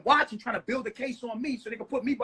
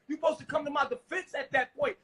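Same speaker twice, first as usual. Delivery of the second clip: ordinary talk, nothing marked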